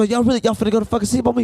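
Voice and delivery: fast, outraged complaint; mock indignant. Complaining the friends fell asleep on him, fast indignant. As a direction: rattle it off, betrayed.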